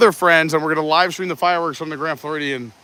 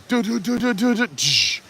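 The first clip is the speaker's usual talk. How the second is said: vocalized build-up beat ending in a hiss; DJ drop sounds; 4 semitones higher, 3 dB quieter than usual. Shown as a demonstration. Demonstrating a Disney DJ set drop, mouthed beat sounds. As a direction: sing out the synth riff, then a cymbal crash. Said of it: "drop where it's like".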